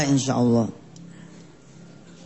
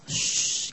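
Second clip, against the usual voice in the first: hushing shh; 9 dB quieter than usual. Acted. Hushing the crowd.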